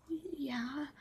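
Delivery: hushed and reluctant; meek answer. Retold or imitated. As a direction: imitate a timid friend agreeing softly. Lisa meekly answers when confronted.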